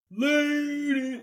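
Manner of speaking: very high, drawn-out mimicking call; high-pitched, stretched-out echo of a yell; son yelling it back. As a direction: imitate a kid copying the neighbor's holler. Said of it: "starts yelling it back".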